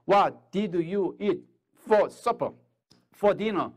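very slow English question; slow demo. Shown as a demonstration. Teacher modelling a question to an American, slow. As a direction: draw the words out for learners. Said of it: "미국 사람이 있어요".

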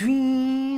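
high, loud zooming noise; super fast zoom. Retold or imitated. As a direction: imitate shooting off at top speed. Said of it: "like a super fast, energetic".

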